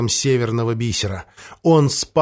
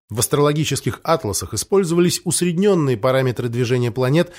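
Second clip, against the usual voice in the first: ordinary delivery, nothing marked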